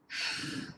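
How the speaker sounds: long breathy sigh; heavy sigh. A narrator's reading. Gloomy sister finally replies, sighing. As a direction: let out a weary sigh.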